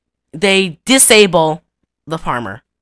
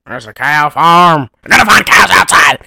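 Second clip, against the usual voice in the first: dim farmer; 6 dB louder than usual. Retold or imitated. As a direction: play the dazed farmer, hammed up. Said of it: "he goes".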